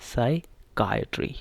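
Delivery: साइकियाट्री slowly in two parts, sai, KAI-uh-tree; model pronunciation. Shown as a demonstration. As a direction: break साइकियाट्री after "sai" and stress "KAI". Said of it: "जो नीचे है वो है". (साइकियाट्री धीरे-धीरे, "साइ-कायट्री")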